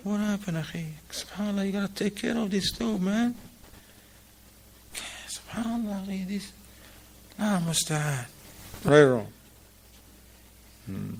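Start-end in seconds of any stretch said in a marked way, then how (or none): 0.1-8.3 much higher, softer mock pity; pitying onlookers. People at Asr pitying the brother's stained thobe, in high mock sympathy. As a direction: sigh over the stains with exaggerated sympathy. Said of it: "What they say?".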